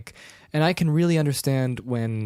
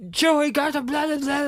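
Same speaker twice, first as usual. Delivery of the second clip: very high, loud babble; hammed-up high-pitched urgency; mock frantic shouting; 4 dB louder than usual. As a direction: yell gibberish orders in a hurry. Acting out hurried escape scene.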